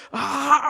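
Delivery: a high yelp of pain; imitating the yelp. Pastor showing the congregation his cry of pain again, high yelp. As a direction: show them how it hurt. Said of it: "Algo así".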